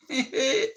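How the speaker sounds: short, high-pitched giggle; quick high laugh; amused giggle. Real, not acted. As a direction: giggle at your own silly rhyme. Laughs at own sung rhyme.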